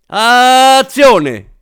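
very high, drawn-out yell; director's shout. A narrator's reading. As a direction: shout the cue across the set. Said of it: "urlò Morin". (urlo acuto da regista)

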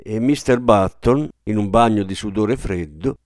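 usual speaking voice

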